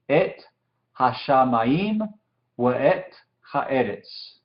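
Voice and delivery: slow, word-by-word Hebrew recitation; slow careful reading. Shown as a demonstration. Teacher reading Genesis in Hebrew to students, slow and measured. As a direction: read it out slowly, separating each word.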